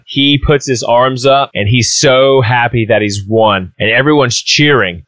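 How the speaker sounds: ordinary talk, nothing marked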